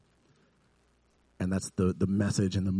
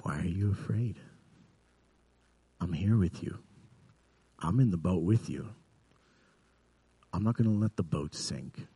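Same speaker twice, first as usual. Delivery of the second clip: soft, unhurried comforting; steady them quietly, pausing between phrases; gentle reassurance; 6 dB quieter than usual. Retold or imitated. Jesus comforts those afraid in the boat, softly reassuring.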